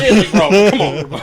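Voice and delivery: mock the villain's coaxing while cracking up; laughing imitation. Laughing mock Penguin plea.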